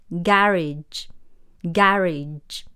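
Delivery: slow British "garage", stressed GA-ridge; British pronunciation. Shown as a demonstration. Teacher models the word for car shed, British accent. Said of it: "英国人则是说garage".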